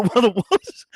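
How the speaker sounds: slow, laughing words. Retold or imitated. Retelling the darts argument, laughing. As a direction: giggle out the end.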